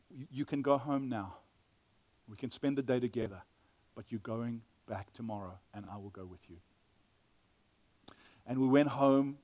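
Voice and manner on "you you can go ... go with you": gentle reassurance. Retold or imitated. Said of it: "I, I said".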